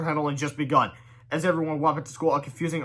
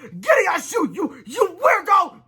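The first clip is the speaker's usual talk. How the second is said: very high, slow, loud outburst; high slow angry yell; furious screech; 7 dB louder, slower than usual. Acted. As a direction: drive the weirdo off. Mother attacks stranger with purse.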